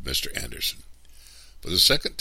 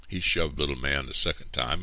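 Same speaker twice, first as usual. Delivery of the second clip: usual speaking voice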